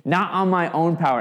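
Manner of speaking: David mocking Goliath's threat; mocking trash talk. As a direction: throw the insult back with a sneer. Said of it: "righteous trash talk from David".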